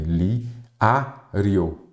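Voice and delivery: "li", "a", "rio" each set apart by pauses; syllable by syllable. Shown as a demonstration. Teacher finishing the division of imobiliário, syllable by syllable. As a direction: separate "li, a, rio" into distinct syllables. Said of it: "aí vai fazer aqui também".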